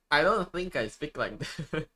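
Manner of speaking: normal talking voice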